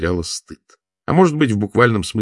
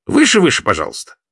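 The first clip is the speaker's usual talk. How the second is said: shouted high and louder, demanding; 7 semitones higher, 5 dB louder than usual. A narrator's reading. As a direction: yell it up at her from the stalls. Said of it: "требовательно закричал".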